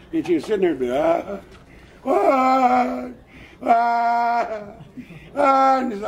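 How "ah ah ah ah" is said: rising drawn-out wails, louder as they climb; crying wails. Acted. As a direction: sob it out, each ah higher. Lost girl cries alone.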